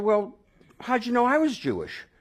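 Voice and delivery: very high, eager and amazed; excited question. Retold or imitated. Asking the stranger how he knew, high and excited. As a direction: press him, bursting with curiosity.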